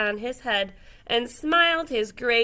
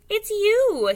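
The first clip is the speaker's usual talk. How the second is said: high, louder porcupine voice; a perky little porcupine; 10 semitones higher, 5 dB louder than usual. A narrator's reading. Porcupine greets friends.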